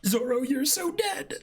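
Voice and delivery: high slow mock-doomed wail; high excited dread. Real, not acted. Reacting to scene.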